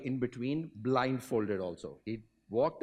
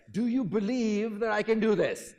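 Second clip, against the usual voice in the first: louder, higher showman's call; rally a huge crowd with it; enacted Great Blondin; 8 semitones higher, 6 dB louder than usual. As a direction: daredevil shouting out to the crowd. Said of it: "enacting the Great Blondin".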